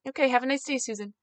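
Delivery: politely cheerful brush-off with a smile; forced cheer. Retold or imitated. Canvasser leaving a cold doorstep with a smile, forced cheer. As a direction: sing out a smiling goodbye, trailing softly. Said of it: "happy smile on my face".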